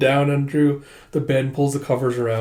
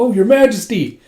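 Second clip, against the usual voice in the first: higher, louder mock startled cry; startled exclamation; 9 semitones higher, 7 dB louder than usual. Soldier caught off guard by his king, startled cry.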